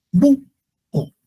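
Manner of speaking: "Búho" as high BÚ, pause, o; hiatus demo. Shown as a demonstration. Teacher showing distinct syllables, split "Búho". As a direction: separate both vowels in "Búho". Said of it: "sílabas distintas".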